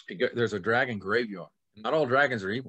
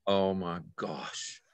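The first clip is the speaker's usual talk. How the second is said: slow, soft and lower mortified gasp; awed; 5 semitones lower, 6 dB quieter, much slower than usual. Acted. Peasant boy realizing he grabbed the princess, slow and soft. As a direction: let it slip out slowly under the breath. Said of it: "And he goes".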